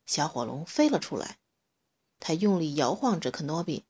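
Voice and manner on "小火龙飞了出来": plain reading voice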